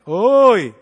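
loud hey-you call; mock yell. Boyfriend calling girlfriend rudely, loud. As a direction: shout it rudely. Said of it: "연애할 때".

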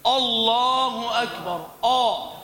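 "الله أكبر" opened on a dark, heavy "آ"; wrongly heavy hamza. Shown as a demonstration. Teacher demonstrates a faulty letter, heavy opening hamza. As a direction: chant "الله أكبر" slowly with a thickened first vowel. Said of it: "أصلح هذا الحرف".

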